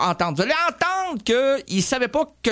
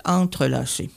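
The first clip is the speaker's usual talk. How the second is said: dictation; 8 semitones lower than usual. Shown as a demonstration. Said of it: "prends ça en note".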